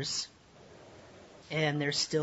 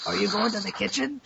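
a lightly voiced Boffer, quick and a touch higher, leaning in; Boffer suddenly close; 5 semitones higher, faster than usual. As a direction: pop up right at his shoulder and ask it quickly. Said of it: "really close to you and says".

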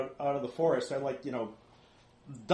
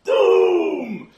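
lower, very loud, drawn-out shout; creature's doom cry; 5 semitones lower, 14 dB louder than usual. Acted. Creature points at the player, ominous bellow. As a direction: bellow it long like a dire omen. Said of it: "he points at you and he says".

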